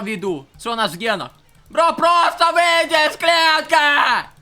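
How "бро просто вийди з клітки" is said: slow, loud, exasperated yell; frustrated shout. Real, not acted. Streamer yelling at his character to leave the cage. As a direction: shout it out, fed up with waiting.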